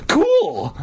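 high, excited exclamation; Will Wheaton. A narrator's reading. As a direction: exclaim it with boyish glee. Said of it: "I exclaimed like Will Wheaton".

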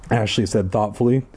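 usual speaking voice

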